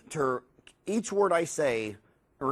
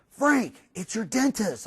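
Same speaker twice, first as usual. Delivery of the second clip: making a big deal; 6 semitones higher, slower than usual. Retold or imitated. Relative calling Frank to the dentist's phone call, excitedly making a big deal. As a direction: call out the big news to the household. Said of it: "they make a big deal".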